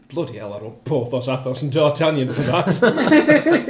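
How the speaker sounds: indignant father. Retold or imitated. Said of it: "He said".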